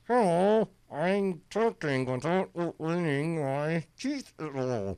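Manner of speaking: talking through closed teeth, slow; ventriloquist voice. Shown as a demonstration. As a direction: speak without moving your lips. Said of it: "ventriloquist style saying".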